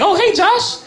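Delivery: very high, cheery hello; high greeting. Pretend. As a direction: chirp it brightly. Zombie coworker voiced.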